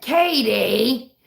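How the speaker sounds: imitating dad. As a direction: say the name the way dad does. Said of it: "like he does".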